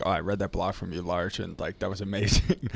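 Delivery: soft, hushed mock praise; quietly imitating a gushing friend; a hushed, gushy imitation of a buddy praising the blog. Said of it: "he was like".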